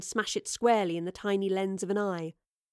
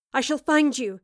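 raised voice calling to friends; 5 semitones higher, 5 dB louder than usual. A narrator's reading. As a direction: call it out as a promise. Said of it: "she called".

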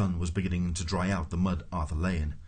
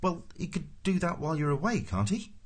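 mildly baffled, higher-pitched question; puzzled; 10 semitones higher than usual. A narrator's reading. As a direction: ask it in innocent puzzlement.

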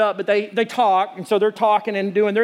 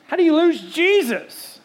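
incredulous; 8 semitones higher than usual. Real, not acted. Preacher incredulous at lost Jesus.

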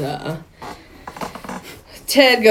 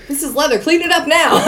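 mock-stern order, pitched up; playing the outraged car owner, laughing; bossy demand; 8 semitones higher, faster than usual. To his friend about the leather seats, mock-stern order.

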